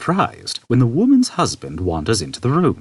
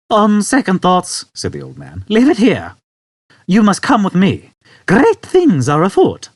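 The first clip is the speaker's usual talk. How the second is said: put-on decisive old man; 11 semitones higher, 5 dB louder than usual. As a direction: announce it firmly.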